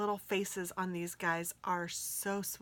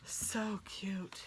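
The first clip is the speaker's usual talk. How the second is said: whisper. Real, not acted. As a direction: whisper it tenderly. Awed whisper.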